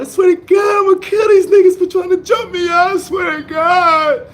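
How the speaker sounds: high shouted sobbing vow, slowing at the end; tearful shouted promise of revenge; crying threat. Retold or imitated. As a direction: yell it through tears, furious and hurt. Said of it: "I was already crying".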